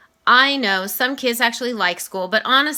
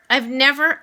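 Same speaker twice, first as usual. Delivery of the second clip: ordinary delivery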